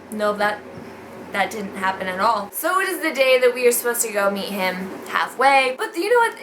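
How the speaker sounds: ordinary talk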